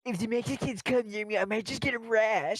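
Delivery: high, nose-held mock voice; pinched nose. Joining friends' voice game, high pinched-nose voice. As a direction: push it high with the nose closed. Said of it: "This is how I sound when I close my nose".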